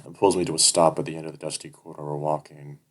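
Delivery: ordinary delivery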